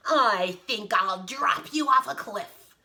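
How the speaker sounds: loud, threatening giant; big bullying giant voice; giant bellow. Acted. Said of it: "bellowed the giant".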